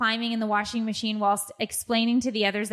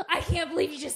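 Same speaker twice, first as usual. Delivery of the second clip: 1 shocked laughing gasp; 8 semitones higher, faster than usual. Real, not acted. Shocked laughing at husband's remark.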